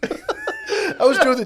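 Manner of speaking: breaks into a high laugh mid-sentence; giggling as the words come out. Real, not acted. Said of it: "laughing while telling".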